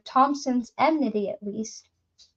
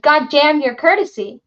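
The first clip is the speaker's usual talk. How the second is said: loud, higher furious cut-off; angry shout; 4 semitones higher, 7 dB louder than usual. Acted. Drunk woodcutter shouts down the visitor, furious. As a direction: bark it over his polite words.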